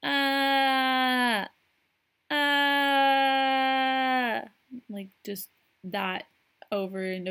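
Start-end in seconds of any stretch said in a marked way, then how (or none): 0.0-4.4 high, loud fake-crying wails; imitated toddler cry. Mimicking her son lying on the floor, loud mock wailing. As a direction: wail like a toddler on his back. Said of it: "just start going".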